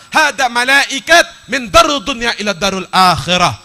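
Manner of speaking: loud, high-pitched sermon storytelling; full-force preaching. Acted. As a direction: declaim the sacred story to a big crowd.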